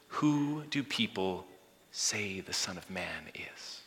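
slow, soft, fading to a whisper; hushed question. Acted. Preacher voicing Jesus asking disciples, slow hushed whisper.

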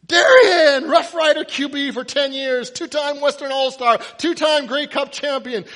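high, loud stream of shouted facts; yelling. Acted. Fan reciting the quarterback's school record, yelling. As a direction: shout the list like a frantic fan.

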